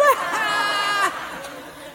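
shrieking laugh at the loser; high mocking laugh. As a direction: laugh him off the stage. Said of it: "the Portuguese guy's laughing".

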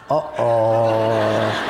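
low, drawn-out uh-oh; comic sinking feeling; sheepish dread. Retold or imitated. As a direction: realize you've just been caught. Caught insulting car's owner.

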